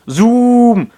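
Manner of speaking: loud, high, drawn-out happy cry. A narrator's reading. Narrator voicing happy Mister Saturn, high and loud. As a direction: call it out high and joyful. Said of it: "the Mister Saturn replied happily".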